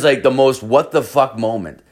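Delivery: ordinary delivery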